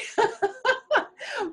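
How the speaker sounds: high, rolling laugh; real burst of high laughter; delighted laugh. Real, not acted. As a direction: laugh heartily at a closing joke. Host laughs after guest's closing line.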